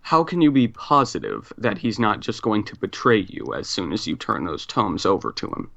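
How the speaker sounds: ordinary delivery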